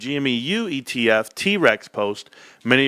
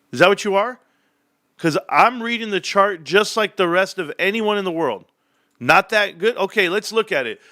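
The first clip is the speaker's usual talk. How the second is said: raised, heated questioning of a viewer; irritated rant; 7 semitones higher than usual. Real, not acted. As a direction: fire back at the chatter, exasperated.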